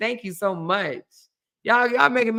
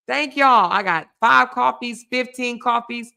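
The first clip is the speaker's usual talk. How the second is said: ordinary talk, nothing marked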